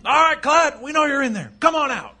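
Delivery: high, loud call into a distance; shouted challenge. Acted. Solo calls Clyde out of the mine, shouting. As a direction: shout it out to a hidden outlaw. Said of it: "and shouted".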